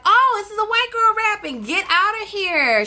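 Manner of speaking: high-pitched mock disbelief; retold amazement. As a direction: act out your astonished first reaction, pitched high. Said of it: "And I was like".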